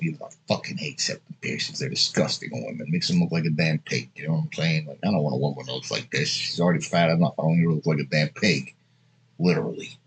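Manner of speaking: muttered aside. Real, not acted. Muttered aside of real opinion.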